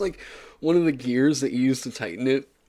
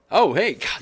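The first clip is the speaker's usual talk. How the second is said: loud, high startled yelp; real fright, blurted out; 6 semitones higher, 7 dB louder than usual. Real, not acted. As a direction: jump at a sudden noise. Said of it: "that actually scared me for a second".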